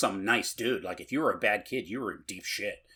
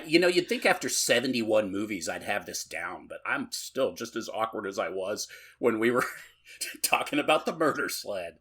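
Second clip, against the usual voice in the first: usual speaking voice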